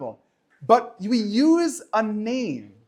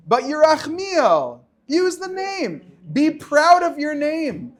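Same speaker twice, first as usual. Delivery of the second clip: rising, louder, higher insistent pep talk; fired-up retold plea, slower on the names; passionate retold urging; 4 semitones higher, 6 dB louder, slower than usual. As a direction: urge him loudly to be proud, building up. Retold exhortation to telemarketer.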